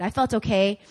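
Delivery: normal talking voice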